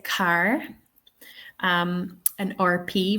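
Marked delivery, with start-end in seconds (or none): 0.0-0.6 car with the final R pronounced; Irish "car", R sounded; Irish accent. Shown as a demonstration. Teacher says it her own way, Irish accent. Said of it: "I would say".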